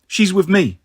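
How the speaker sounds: ordinary delivery, nothing marked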